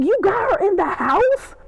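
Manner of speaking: high-pitched mock outrage; incredulous squeal of a reaction; shocked disbelief. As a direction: exclaim it high, in scandalized disbelief. Acting out own shocked reaction.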